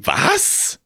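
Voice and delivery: sudden high surprised squeak; surprised Death. Acted. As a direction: jump up in surprise.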